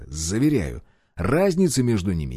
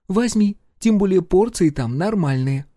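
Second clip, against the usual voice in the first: wife voice; 9 semitones higher, 3 dB louder than usual. A narrator's reading. Wife voice continues.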